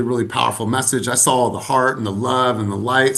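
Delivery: ordinary talk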